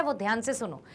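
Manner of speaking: usual speaking voice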